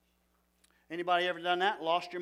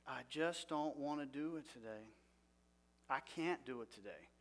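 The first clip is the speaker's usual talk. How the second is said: soft, lower, resigned; quiet defeat; 4 semitones lower, 11 dB quieter than usual. Retold or imitated. Preacher acting out waking with depression, soft and hopeless. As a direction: let the words sink with no energy. Voicing depressed self, repeated.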